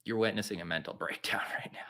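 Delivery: low, whispery mock breakdown; hushed confession. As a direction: drop to a defeated near-whisper. Deadpan aside on own breakdown.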